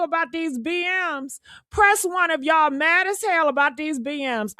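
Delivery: ordinary talk, nothing marked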